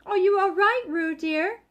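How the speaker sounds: worried mother calling; anxious call. A narrator's reading. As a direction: call out to her child anxiously. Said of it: "called Kanga anxiously".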